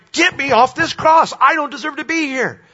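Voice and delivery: angry demand. Retold or imitated. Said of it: "he's angry because he's like".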